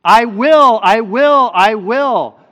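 loud, high preacher's proclamation; emphatic promise. Acted. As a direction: ring it out as God's promise. Voices God's promises emphatically.

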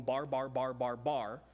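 lightly voiced, low babbling of nonsense syllables; mock barbarian babble. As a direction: mimic foreigners babbling, only a hint of a voice. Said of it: "for somebody to walk around going".

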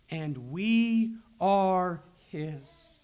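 each word slowed and weighted, "we are his" drawn out; slow emphasis. Shown as a demonstration. Preacher stressing to the congregation that we belong to God, slow and weighty. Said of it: "That is God's own emphasis".

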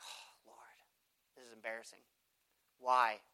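soft embarrassed whisper to God; whispered prayer. Retold or imitated. Preacher whispering to God after a door slams, embarrassed. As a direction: breathe it out, mortified.

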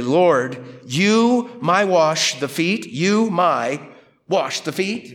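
halting word-by-word delivery leaning on "you" and "my"; emphatic pronouns. Shown as a demonstration. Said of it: "you can kind of hear the emphasis".

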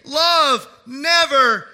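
loud, slow, ringing pulpit delivery; full-force preaching. Acted. Preacher to congregation, loud and slow. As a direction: proclaim it to the whole church.

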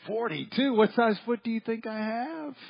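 high, amazed inner thought; mock-incredulous, rising high; mock disbelief. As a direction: gasp at the shoe size in disbelief. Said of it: "I'm thinking".

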